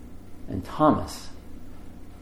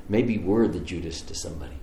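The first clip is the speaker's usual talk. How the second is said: ordinary talk, nothing marked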